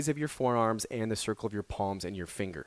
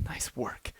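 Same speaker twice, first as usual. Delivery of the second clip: soft winded whisper; out of breath; 9 dB quieter than usual. Real, not acted. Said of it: "As I'm, like, out of breath".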